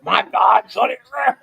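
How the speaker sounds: loud shouted demon voice; demon bellow. Pretend. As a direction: bellow it like a monster. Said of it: "That's my demon voice".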